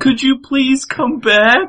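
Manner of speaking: high, slow falsetto plea imitating a woman; drawn-out mock-female pleading, way up high; put-on woman's voice. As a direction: beg in a hammed-up chick voice. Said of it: "doesn't even sound like a chick".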